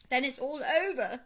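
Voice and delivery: hammed-up despair; mock sob. A narrator's reading. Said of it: "sobbed the toad".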